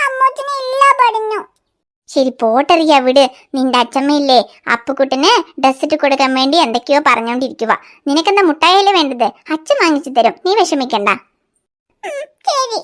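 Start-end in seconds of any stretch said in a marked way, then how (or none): 2.1-11.1 father voice. Acted. Father soothing his little daughter about sweets, much lower voice. 12.0-12.8 put-on sulky crying child.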